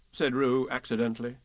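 ordinary delivery

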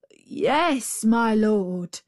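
obedient little reply; a hint of servile jackal; meek jackal. A narrator's reading. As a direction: answer humbly as the jackal.

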